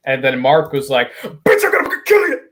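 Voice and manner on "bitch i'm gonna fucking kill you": hammed-up furious shouting. Angry father threatening the doctor over his baby, hammed-up high yelling. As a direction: screech out a mock tirade on the edge of laughter.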